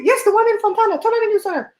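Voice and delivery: high-pitched urgent phone yell, imitating dad; urgent calling out. Retells dad's urgent phone call.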